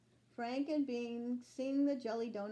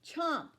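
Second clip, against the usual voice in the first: chomp; 7 semitones higher, 5 dB louder than usual. A narrator's reading. Sound words of eating donut.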